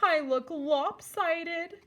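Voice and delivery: mild self-pity over a broken tusk; sulky lament. Acted. Ganesha sulks over tusk.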